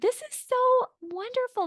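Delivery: soaring high, overjoyed gush; mock gushing delight. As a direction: gush like it's pure bliss. Mock-gushing quote of a delighted eight.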